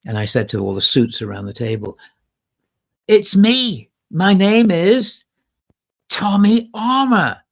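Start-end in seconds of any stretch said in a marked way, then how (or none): 3.1-7.4 proud, slow, loud declaration of his name; loud slow announcement. Retold or imitated. To the record executives, a loud proud slow self-introduction. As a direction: boom out your name to the room.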